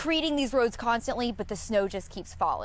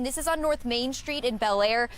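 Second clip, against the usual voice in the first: ordinary talk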